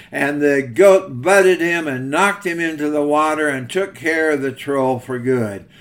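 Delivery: normal talking voice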